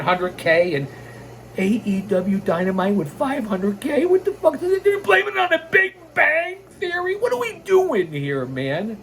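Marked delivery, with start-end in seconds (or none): 4.0-9.0 high, disbelieving what-the-hell; incredulous outburst. Real, not acted. Podcaster ranting at a lame ratings excuse, incredulous. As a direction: throw up your hands in disbelief.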